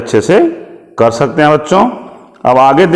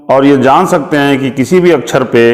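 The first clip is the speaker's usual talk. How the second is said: ordinary talk, nothing marked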